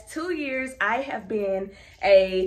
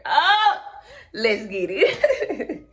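laughing; 6 dB louder, much slower than usual. Real, not acted. Laughing after pep line.

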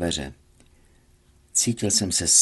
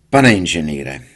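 curt; 4 semitones lower, 7 dB louder than usual. A narrator's reading. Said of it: "střelil po mně úsečně".

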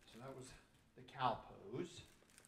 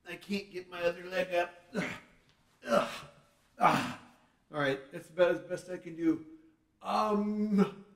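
loud pained grunts of a stiff dad; straining groans; 5 semitones higher, 14 dB louder, slower than usual. Real, not acted. Dad straining to his kids, loud and groaning. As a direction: push through the stretch, groaning.

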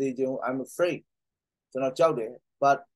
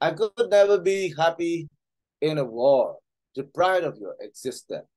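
slow reading, starting higher; 3 semitones higher, much slower than usual. Acted. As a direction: read the line out slowly and clearly. Reads English line slowly.

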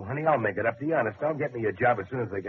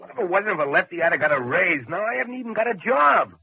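louder and higher, bitter and resentful; angry outburst; 8 semitones higher, 7 dB louder than usual. Acted. Husband venting at his wife over the lost job, loud and angry. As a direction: let the grievance burst out loud.

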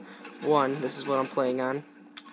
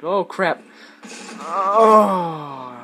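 loud, higher cry of dismay; dismayed outcry; 4 semitones higher, 9 dB louder, much slower than usual. Real, not acted. Gamer suddenly killed mid-sentence, crying out in dismay. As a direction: cry out, stretching the final oh.

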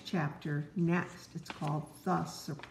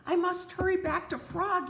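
higher, louder hurried fretting; anxious cry; 11 semitones higher, 3 dB louder than usual. Acted. Parent voicing Toad rushing back to Frog, higher and anxious. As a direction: Toad panics about the melting cones. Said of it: "he cried".

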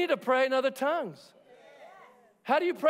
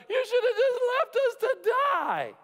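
whining Israelites; 4 dB louder than usual. Retold or imitated. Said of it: "they're like".

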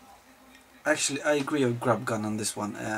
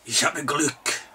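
barked German, louder and higher, mock fury; mock angry German; 4 semitones higher, 7 dB louder, slower than usual. Shown as a demonstration. Podcast host mocks German as sounding angry, loud mock anger. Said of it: "Sounds like you're fucking angry when you speak German".

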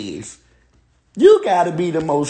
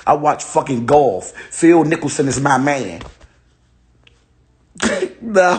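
ordinary talk

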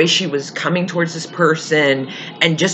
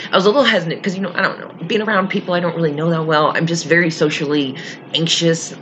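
normal talking voice

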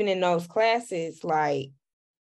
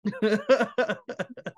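amused laugh; 2 semitones higher than usual. Real, not acted. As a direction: burst of chuckling. Laughing at a great professor remark.

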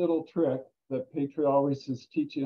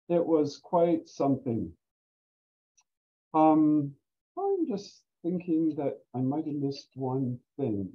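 ordinary talk

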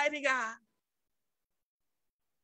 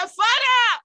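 loud, high call to Father; retold outcry, pitched up and loud; crying out; 6 semitones higher, 7 dB louder than usual. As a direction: cry out suddenly to God. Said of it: "I cried".

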